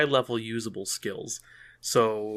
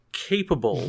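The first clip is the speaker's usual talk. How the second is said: "capable" set apart and raised; stress on capable; 4 semitones higher than usual. Real, not acted. Stressing a caveat on every investigator, emphatic "capable". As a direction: underline "capable", pausing before it. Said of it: "I'm gonna underline this word".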